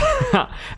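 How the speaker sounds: short high-pitched giggle; squeaky laugh. Real, not acted. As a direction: let a tiny squeal of laughter slip out. Laughing at a teammate's throw.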